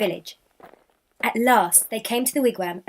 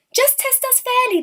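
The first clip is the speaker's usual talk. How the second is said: much higher, pleading exclamation; indignant exclamation; 4 dB louder than usual. A narrator's reading. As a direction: protest shrilly that it isn't fair. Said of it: "they exclaimed".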